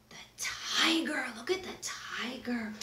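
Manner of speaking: hushed tense whisper. Acted. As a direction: whisper so the tiger won't hear. Whispering about tiger.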